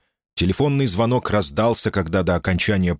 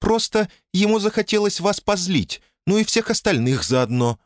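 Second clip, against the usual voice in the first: much higher, thin woman's voice; thin little voice; 13 semitones higher than usual. A narrator's reading. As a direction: keep the voice thin and high. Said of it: "тоненьким голосом проговорила она".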